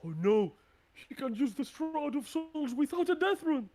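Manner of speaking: very high, loud cry of alarm; panicked yell. Acted. Giant seeing the Shroud of Souls used, panicked yell. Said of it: "this one will yell".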